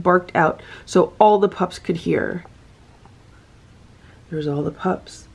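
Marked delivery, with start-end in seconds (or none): none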